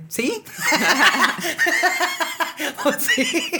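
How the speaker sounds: high-pitched laughing disbelief. Real, not acted. Incredulous retort about outfit.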